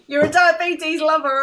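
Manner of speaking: high, loud mock shout; loud, gleeful imitation of a yelled insult; imitated shouting. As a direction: shout it after someone in a high-pitched impression. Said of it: "I like when he's shouting".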